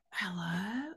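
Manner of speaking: whispered, groggy hello; sleepy. Acted. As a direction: murmur it as if just woken. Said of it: "very, very, um, sleepily going".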